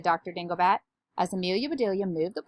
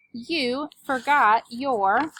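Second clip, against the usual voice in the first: slow, high, louder call; 5 semitones higher, 6 dB louder, much slower than usual. A narrator's reading. Mister Rogers calls after departing Amelia Bedelia, drawn-out calling. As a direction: stretch the words to carry far. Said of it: "he called out".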